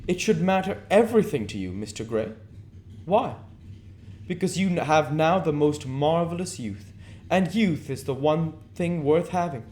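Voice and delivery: lifted, persuasive aristocrat; Lord Henry voice. Acted. Lord Henry praising youth to Dorian, lightly voiced lord.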